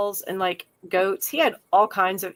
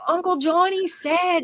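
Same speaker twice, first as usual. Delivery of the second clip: put-on higher, slow, drawn-out kid's protest; insistent child; 7 semitones higher than usual. Child telling mom what uncle said, insistent. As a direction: plead the case like a kid quoting an uncle.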